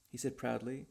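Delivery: plain reading voice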